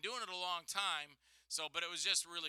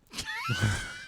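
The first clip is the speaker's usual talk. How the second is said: high, shouted laugh; loud burst of laughter; 9 dB louder than usual. Real, not acted. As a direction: crack up at the mess. Laughing at failed call.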